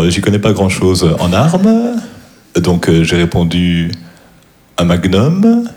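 deadpan storyteller stretching each last word upward; halting delivery with long rising tails on final words; stand-up comedian imitation. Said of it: "je vais faire des imitations".

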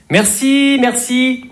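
high, sugary thank-you; fake polite thanks. Shown as a demonstration. Comedian mimicking an annoyed thank-you, high and sugary. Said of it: "quand on dit".

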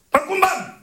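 high, lightly altered "Pam, Koum, Bam"; second version. Shown as a demonstration. Comedian giving the girl's version of the name, high. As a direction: call out the female version. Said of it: "deuxième version".

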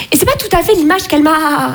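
usual speaking voice

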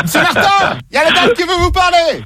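high, lightly voiced colleague calling across the office. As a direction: call out to the boss as a put-on colleague. Playing a colleague calling the boss.

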